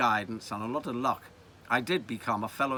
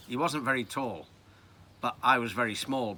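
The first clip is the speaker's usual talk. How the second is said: plain reading voice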